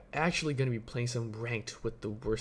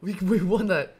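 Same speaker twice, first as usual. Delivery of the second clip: loud high triumphant shout; victory cheer; 8 semitones higher, 12 dB louder than usual. Real, not acted. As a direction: celebrate the win loudly. Win celebration.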